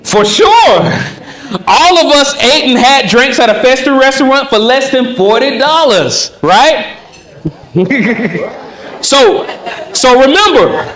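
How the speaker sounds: answers through a laugh; laughing reply. Shown as a demonstration. Role-play with laughing reply.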